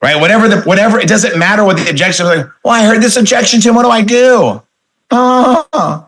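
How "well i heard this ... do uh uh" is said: mock helpless complaint. Trainer mimics recruit stuck on objection, mock helpless. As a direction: imitate a clueless recruit, high-pitched. Mimics a whiner, then answers.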